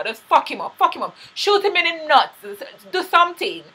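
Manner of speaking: pleading with the show to act; fired-up fan. Real, not acted. Fan yelling at Tasha to hurt Ghost, rising and fired up. Fan urging character on screen.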